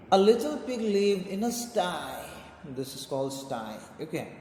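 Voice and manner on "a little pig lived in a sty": lifted, sing-song poem reading. Acted. Teacher reading the poem aloud to pupils, sing-song. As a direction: read it out brightly to the class.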